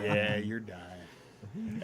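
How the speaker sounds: very slow, drawn-out flat verdict; stretched deadpan confirmation. Retold or imitated. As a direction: drag it out, totally unbothered. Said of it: "walks in and goes".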